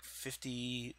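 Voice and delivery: normal talking voice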